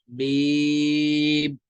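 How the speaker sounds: "मीम" stretched level, closing gently into m; lips closed on meem. Shown as a demonstration. Teacher showing students the ending, drawn out. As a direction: hold the ee of "मीम", then join the lips. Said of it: "दोनों होंठों की खुश्की से को मिलाए".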